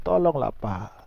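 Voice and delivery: soft, understated begging, as retold; mild mock-tearful appeal; imitated tearful plea. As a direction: quietly mimic someone pleading through tears. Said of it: "Sambil dia nangis".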